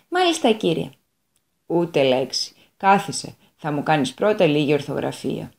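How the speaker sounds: God, curt and in command; stern old God. Acted. God speaks to devil. (ο καλός Θεός, χαμηλά και αυστηρά)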